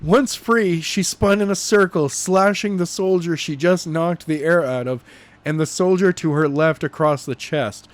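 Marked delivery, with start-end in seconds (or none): none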